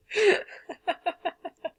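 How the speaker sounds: high laugh at the text; amused laugh. Real, not acted. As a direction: laugh out loud. Laughs at the story.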